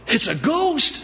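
high-pitched comic yelp of fright; hammed-up terrified squeal; mock panic. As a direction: shriek it as a joke. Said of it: "And you go".

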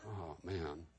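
rebuked by a roommate, quietly crushed; deflated groan. Retold or imitated. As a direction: let the air go out of you. Caught out by his roommate.

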